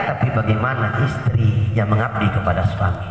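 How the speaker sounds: ordinary delivery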